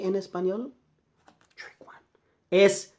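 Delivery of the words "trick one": whispered aside; teacher whispering a hint. Acted. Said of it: "whispered aside".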